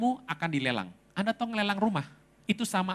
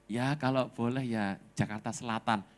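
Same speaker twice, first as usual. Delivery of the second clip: low, softer, tentative imitated reply; hesitant; 7 semitones lower, 5 dB quieter than usual. Husband unsure what to ask of God, hesitant. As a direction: answer timidly, not daring to ask. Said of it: "Yang tegas Pak di mana".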